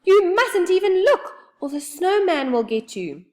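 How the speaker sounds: high, loud urgent warning; alarmed warning. Acted. Moonface warns the girls about the snowman. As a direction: warn them fast and scared.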